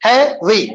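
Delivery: Heavy broken as "है … वी"; split into syllables. Shown as a demonstration. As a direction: say heavy in two separate beats. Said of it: "heavy में कितने बोलिए, दो है". (Heavy को दो हिस्सों में तोड़कर)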